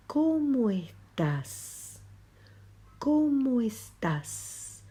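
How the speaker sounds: ¿Cómo estás? said very slowly, Cómo stressed; slow translation. Shown as a demonstration. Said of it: "Les dije que pos era cómo". (¿Cómo estás? muy lento, acento en Cómo)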